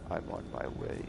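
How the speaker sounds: whispered creepy yandere; overacted anime dub. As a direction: hush it like a lovesick stalker. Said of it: "like, yondeer".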